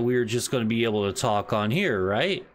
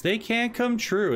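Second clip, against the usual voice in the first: ordinary talk, nothing marked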